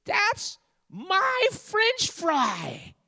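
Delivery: put-on very high-pitched little girl shriek, dragged out; hammed-up high-pitched daughter's cry. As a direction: wail it as an outraged daughter at the top of her voice. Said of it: "cries out at the top of her voice".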